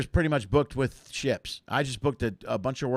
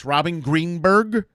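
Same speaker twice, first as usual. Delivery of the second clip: slow, deliberate, louder "Robin Greenberg"; careful name; 4 semitones higher, 7 dB louder, much slower than usual. Real, not acted. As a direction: say the name slowly so it comes out right. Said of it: "I wanna make sure I say that".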